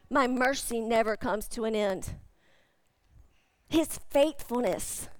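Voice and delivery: ordinary talk, nothing marked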